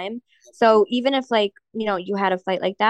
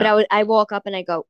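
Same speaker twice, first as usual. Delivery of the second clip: ordinary delivery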